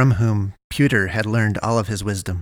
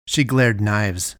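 plain reading voice

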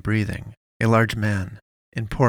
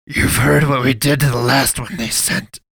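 louder, higher priest voice, proclaiming; announcing; 4 semitones higher, 7 dB louder than usual. A narrator's reading. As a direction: announce it like a threat from the dark. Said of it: "he announced".

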